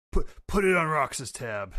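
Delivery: a hint of a gruff old captain; voicing Whitebeard. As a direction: toss the line off on the way out. Whitebeard quoted leaving.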